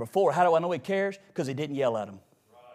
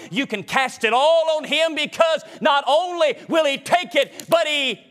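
high, driving revival preacher delivery; full-force preaching; 10 semitones higher, 4 dB louder than usual. Acted. Preacher to congregation about God in the storm, full-force preaching. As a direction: preach it hard in rolling, repeated phrases.

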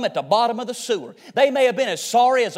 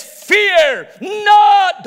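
high, loud preacher's shout; shouted command; 9 semitones higher, 7 dB louder than usual. Acted. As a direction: belt out both words at full force. God's words at sermon climax.

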